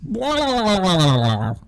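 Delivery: strange gurgling creature voice; monster impression. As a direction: growl like a swamp creature. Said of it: "Give me your best monster impression".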